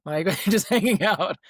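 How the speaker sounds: high-pitched, laughing through the words. Retold or imitated. Athlete teasing students with empty schedules, laughing. Laughs while retelling roommate exchange.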